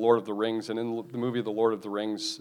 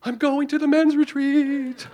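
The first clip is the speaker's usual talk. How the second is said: very high, louder cry; triumphant Smeagol; 14 semitones higher, 5 dB louder than usual. Retold or imitated. Smeagol declaring he will go, very high and glad. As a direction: squeal it out in joy. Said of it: "And then he goes".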